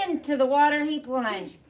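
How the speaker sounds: usual speaking voice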